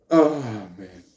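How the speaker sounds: normal talking voice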